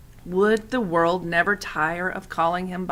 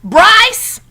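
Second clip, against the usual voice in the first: high, loud call of a name; 13 dB louder than usual. A narrator's reading. Old woman calls the boy away from the rabbit, loud high call. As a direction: call him sharply across the garden.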